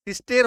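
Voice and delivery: सिस्टेर with ए, as spelt; wrong vowel. Shown as a demonstration. Teacher on what the spelling would give, सिस्टेर with "ay". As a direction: say सिस्टेर ending in "-tayr". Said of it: "लेकिन नहीं हो रहा है".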